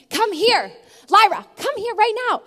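put-on high, loud calling of a child's name; yelling her name. Mom calling her daughter to get pajamas on, loud and high. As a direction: call across the room to a distracted toddler. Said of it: "I'm, like, yelling her name".

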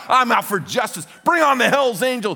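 high, loud battle cry; mock bravado. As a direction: roar like a self-appointed champion. Pumped-up fan looking for a fight.

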